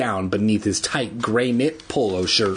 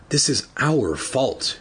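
usual speaking voice

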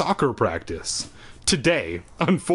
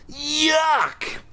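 disgusted. A narrator's reading. Gum spat in ear.

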